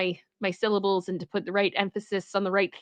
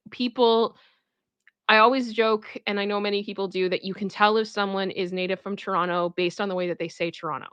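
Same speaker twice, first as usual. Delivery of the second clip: ordinary talk, nothing marked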